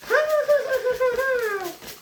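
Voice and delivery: shrill mocking cackle, very high; high wicked laugh; high witch cackle. Witch bit ends in cackle.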